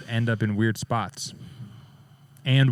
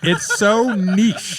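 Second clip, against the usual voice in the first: high, slow, amused through laughs; laughing; 10 semitones higher, 5 dB louder, much slower than usual. Real, not acted. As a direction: squeal it while laughing. Laughing about the joke.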